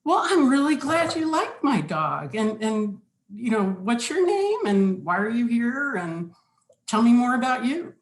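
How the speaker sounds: upbeat welcoming networking voice; friendly interest. Pretend. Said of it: "I should have said".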